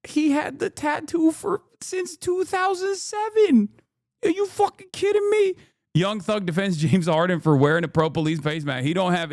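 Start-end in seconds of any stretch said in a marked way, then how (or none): 0.1-5.6 high-pitched are-you-kidding-me; incredulous. Real, not acted. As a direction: voice shoots up in disbelief. Ranting streamer mocking the timeline.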